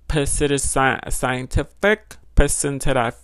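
sounding out "scientific" piece by piece, slowly; struggling to pronounce. Real, not acted. Vlogger reading a definition, struggling to pronounce it. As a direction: stumble slowly through a hard word, cracking up. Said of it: "I don't know how I said that word".

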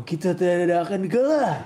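rousing, loud battle cry; fighter hype. Shown as a demonstration. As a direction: read it like a fighter psyching up. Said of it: "Bacanya, bacanya yang semangat".

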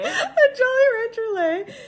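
high, drawn-out words through laughter; laughing. Real, not acted. Repeating the candy's name to her co-host, laughing. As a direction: squeeze it out while cracking up.

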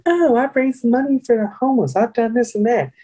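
high-pitched mocking imitation; mock their phony boasting, voice way up; lying job candidates. Candidates boasting to recruiters in a group interview, mocking phony voice. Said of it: "all these people lying their ass off".

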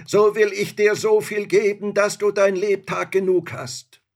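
high, loud, urgent promise; spirit calling out. A narrator's reading. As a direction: call out a promise from captivity. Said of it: "rief der Geist".

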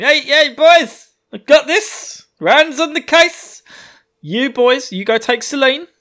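loud, high-pitched rallying call; playful, hammed-up hero taking charge; cocky calling out. Rand acted calling to companions.

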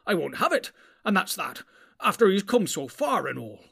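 upset hobbit begging for his pony; angry and distressed. A narrator's reading. Sam to Gandalf about the pony, angry and distressed. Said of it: "angry and distressed".

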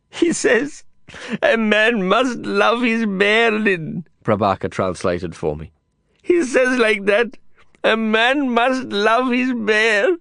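high, slow, earnest Indian English; Prabhakar translating. A narrator's reading. Prabhakar relaying the handler's plea to Lin, slow and solemn.

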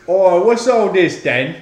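British copper voice. Pretend. Mock British bobby line.

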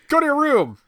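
loud high-pitched dad barking go to your room; mock dad order. Dad ordering his grown son to his room, loud and high. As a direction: shout the order up high, half laughing at yourself.

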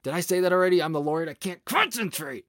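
high, exasperated put-on God voice; flustered God voice. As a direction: whine it like a frazzled deity.

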